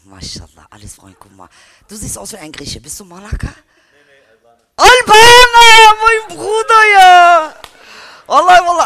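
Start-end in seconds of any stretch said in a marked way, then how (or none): 4.8-8.8 high, loud, drawn-out cheer; excited shout. Acted. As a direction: cheer it out like greeting a brother. Cheering greeting to Albanian. (begeisterter Ruf)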